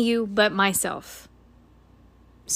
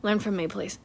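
ordinary delivery

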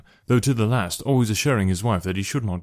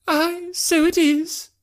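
very high, drawn-out mother's cry; high-pitched, put-on exclamation of dismay; much slower than usual. A narrator's reading. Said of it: "cried her mother".

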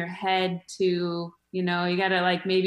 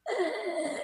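quiet, high strained groan; throat-squeeze grunt; 8 semitones higher, 10 dB quieter than usual. Shown as a demonstration. Comic showing how to squeeze the throat, strained grunt. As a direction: squeeze out a small, tight "ugh". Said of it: "squeeze, like, your throat".

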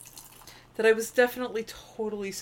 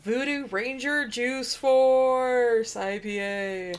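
drawn out, slow product name; slow announcement; 3 semitones higher, much slower than usual. Acted. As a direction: announce it slowly like a prize.

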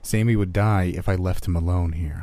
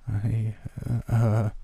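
higher, halting stammer; 4 semitones higher, much slower than usual. A narrator's reading. Bullied kid failing to answer his tormentor, stammering. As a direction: stall on broken, hesitant syllables. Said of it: "the kid was stammering now".

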